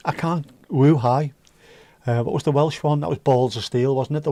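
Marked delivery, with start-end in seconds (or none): none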